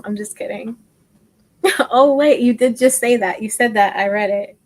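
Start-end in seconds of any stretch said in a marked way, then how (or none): 1.6-4.6 loud, laughing delight at a compliment; laughing excitement. Real, not acted. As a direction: burst out happily, loud and laughing. Reacting to a kind viewer comment.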